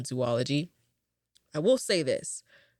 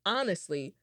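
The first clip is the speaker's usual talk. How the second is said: normal talking voice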